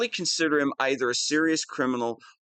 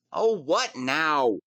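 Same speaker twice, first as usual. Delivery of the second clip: ordinary delivery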